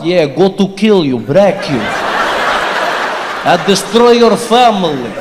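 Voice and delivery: menacing mock Russian accent, slow at the end. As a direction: threaten like a movie Russian. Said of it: "as soon as they open their mouths".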